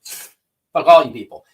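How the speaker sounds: retold defiant outburst. Telling off the hostile chat, loud slow defiance. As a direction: fling it at the whole room, slow and loud. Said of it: "I was in there like".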